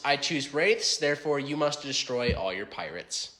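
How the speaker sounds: usual speaking voice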